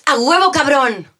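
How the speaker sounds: loud, high, slow Mexico City slang voice; chilanga accent. Pretend. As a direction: belt it out like a proud chilanga. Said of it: "Voy a tratar de ser bien chilanga". (acento chilango, fuerte y agudo)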